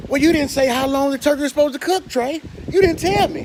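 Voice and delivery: high, complaining viewer voice; mock whiny viewer. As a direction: whine it like a nagging viewer. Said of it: "imagined viewer complaint".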